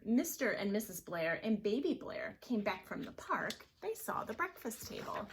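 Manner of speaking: ordinary delivery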